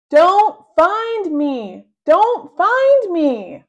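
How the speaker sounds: high, slow, loud angry shout. A narrator's reading. Boy shouting at brother who found him. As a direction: shout it over and over. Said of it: "I shout".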